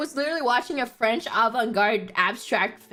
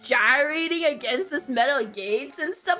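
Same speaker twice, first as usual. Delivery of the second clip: usual speaking voice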